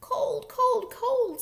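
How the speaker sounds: put-on high, loud little baby voices; tiny high chirps on each word; shivering babies. A narrator's reading. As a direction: squeal it like chilly babies. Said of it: "said the shivering babies".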